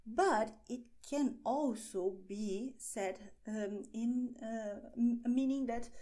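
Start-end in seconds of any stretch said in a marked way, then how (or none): none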